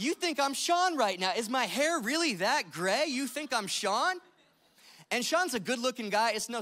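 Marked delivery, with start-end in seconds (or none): 0.0-4.2 higher, louder mock disbelief; playfully aghast at being mistaken; incredulous. Pastor mock-offended at being taken for Sean, incredulous. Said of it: "And I'm like".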